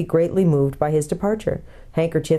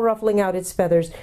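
usual speaking voice

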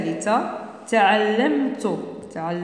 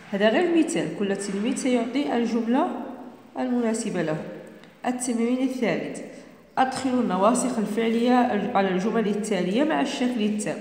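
ordinary talk

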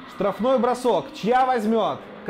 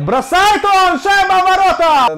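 shouting the goal, high and loud; excited goal call; 11 semitones higher, 10 dB louder than usual. Real, not acted. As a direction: yell it as the puck goes in. Goal scored, commentator yells.

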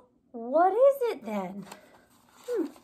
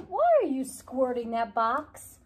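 ordinary delivery, nothing marked

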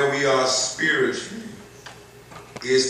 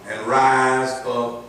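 scripture reading; 2 semitones higher, 4 dB louder, slower than usual. Acted. Slow emphatic scripture reading.

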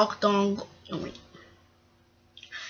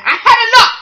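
high, loud fed-up outburst; cross shout; 12 semitones higher, 10 dB louder, faster than usual. Acted. Gordon rejects the controller's scolding, shouting crossly. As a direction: shout it in exasperation. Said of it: "He was so cross".

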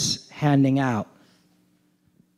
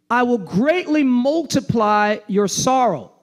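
preaching scripture reading; 8 semitones higher, slower than usual. Acted. Reads curse on woman.

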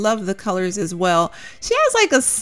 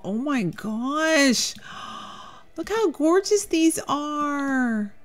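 awestruck; 8 semitones higher, 4 dB quieter, slower than usual. Real, not acted. Overwhelmed by gorgeous clothes.

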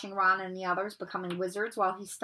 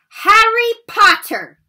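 furious shout; 12 semitones higher, 16 dB louder, slower than usual. Acted. McGonagall furious, running toward him.

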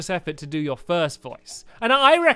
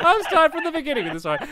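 laughing; 7 semitones higher, faster than usual. Real, not acted. Laughing restart.

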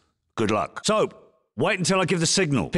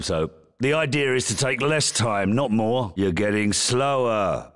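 ordinary talk

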